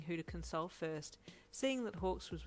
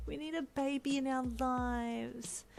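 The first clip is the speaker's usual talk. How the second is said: high, drawn-out whine; whining; 8 semitones higher, slower than usual. A narrator's reading. Boyfriend pleading for a baby, drawn-out whine. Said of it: "Brian whined emphatically".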